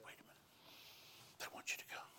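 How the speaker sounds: hushed whisper, breath only. Retold or imitated. Stewardess whispering the request to the pastor. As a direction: whisper it so nobody nearby hears. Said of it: "She's whispering".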